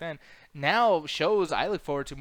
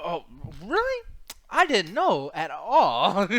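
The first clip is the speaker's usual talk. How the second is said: sarcastic laughing; 5 semitones higher, 6 dB louder, much slower than usual. Real, not acted.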